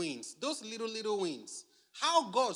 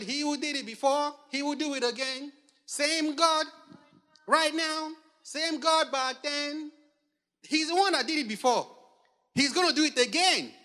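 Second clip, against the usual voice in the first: high, loud declaiming of song words; raised, emphatic pulpit voice; fervent proclamation; 6 semitones higher, 5 dB louder than usual. Real, not acted. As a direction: proclaim each phrase with rising conviction. Preacher declaiming a song's words.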